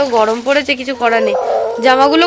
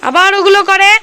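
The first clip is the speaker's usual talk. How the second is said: excited exclaim; 8 semitones higher, 3 dB louder than usual. Real, not acted. Exclaiming at toddler.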